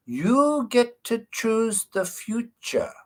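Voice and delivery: imitated old doctor, high and very slow Eastern European accent. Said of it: "kind of Eastern European accent".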